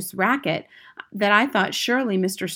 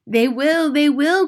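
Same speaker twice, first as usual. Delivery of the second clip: high, excited witch chorus; eager cry; 6 semitones higher than usual. A narrator's reading. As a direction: agree eagerly in raised pitch. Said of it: "cried the audience".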